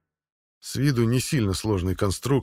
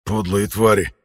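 hoarse; 5 dB louder, slower than usual. Acted. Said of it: "прохрипел с пола Витер".

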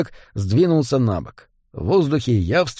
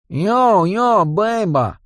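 high, slow street drawl; a hint of a swaggering goblin; cocky goblin calling from behind; lightly voiced goblin gangster; 4 semitones higher, slower than usual. A narrator's reading. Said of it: "гнусавый голос".

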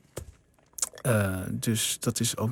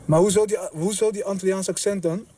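indignant questioning; 6 semitones higher than usual. Acted. Film scene, objecting to agent.

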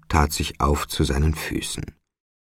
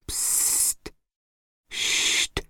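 soft voiceless shushing; hushing; 9 dB quieter than usual. A narrator's reading. Spirit child silences Trotty's questions, soft hushing. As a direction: hush the listener with drawn-out hisses.

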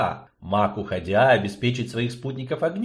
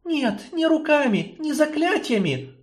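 put-on entranced girl. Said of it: "пробормотала Ярина".

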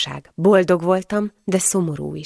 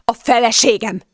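raised, higher angry shout; angry roar; 5 semitones higher, 4 dB louder than usual. A narrator's reading. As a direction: bellow it into the listener's face. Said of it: "ordította már az arcomba". (dühös ordítás)

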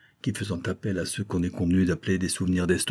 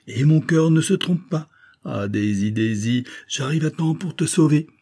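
high, loud hammed-up lover; mock romantic hero; 7 semitones higher, 4 dB louder than usual. A narrator's reading. Coquentin calls to Daisy, high hammed-up romance. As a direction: ham it up like an opera lover. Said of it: "il parodiait le chevalier des Grieux".

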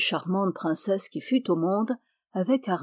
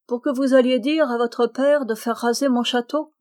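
higher, louder, scornful question; indignant retort; 4 semitones higher, 5 dB louder than usual. A narrator's reading. Lady retorts to sinking princes, indignant. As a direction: throw it back at them haughtily.

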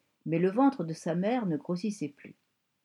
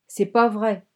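put-on a child's insistent, louder no; stubborn denial; 7 dB louder, slower than usual. A narrator's reading. A little girl contradicting her mother, stubbornly. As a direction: refuse to believe it, digging in. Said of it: "s'était-elle entêtée".

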